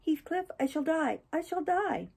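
high, acted frantic begging; shrieked desperate plea. A narrator's reading. Catherine begs her lover not to leave her, desperate and high. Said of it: "she shrieked".

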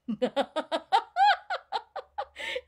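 very high, loud peal of laughter; real laughter. Real, not acted. Laughter coach laughing hard during smile ups. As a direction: laugh freely, ringing out. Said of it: "laughter, as you can tell, is always okay".

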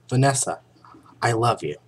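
loud heroic vow; overacted brave lover; hammy stage actor. Barry's dialogue.

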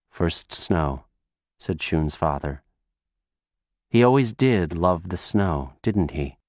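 husband at the bedside, low and gentle; father voice. Acted. Father answers quietly.

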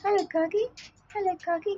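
high, soft coo to a bird; quiet high hello to a pet; soft pet greeting. Real, not acted. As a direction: gently coax the hiding bird. Greeting a shy cockatoo.

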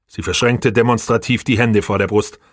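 usual speaking voice

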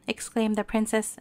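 plain reading voice

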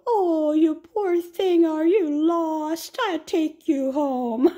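put-on high, slow baby talk. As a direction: coo at a lost animal. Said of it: "talking to 'em in a baby voice".

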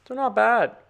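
high, loud pleased burst; pleased exclamation. Real, not acted. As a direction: crow it out, delighted with the drawing. Pleased with drawing.

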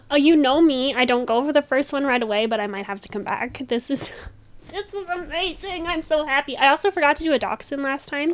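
normal talking voice

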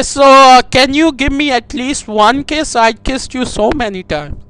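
ordinary talk

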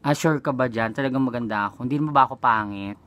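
ordinary talk, nothing marked

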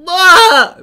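sudden loud high shriek; startled yelp. Real, not acted. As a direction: yelp out in surprise. Streamer suddenly yelps.

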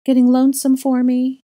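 high, loud mocking question; taunting. A narrator's reading. Dark double mocking Granger alone, high loud taunt.

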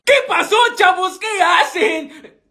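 high, drawn-out tipsy greeting; acting drunk. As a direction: slur a merry hello, stretching the end. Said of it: "¿Estás borracho, [name]?".

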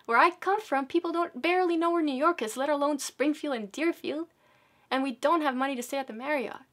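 high, loud, desperate explaining; a mother explaining she cannot afford it; distressed protest. Retold or imitated. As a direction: plead her case urgently. Mom pleading, upset with driver.

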